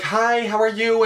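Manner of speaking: drawn-out sweet star hello; imitating sweet greeting. [name] answering the hug, imitated high and slow.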